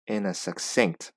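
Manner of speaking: ordinary talk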